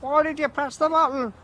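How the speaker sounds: squeaky kid asking, imitating children; kids' voice. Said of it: "kids from all over the bloody world were shouting at me".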